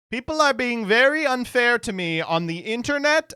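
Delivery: high-pitched presidential griping; mock aggrieved whine. President airing grievances to the nation, mock aggrieved. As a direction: complain with exaggerated hurt. Mocking a presidential address's petty complaint.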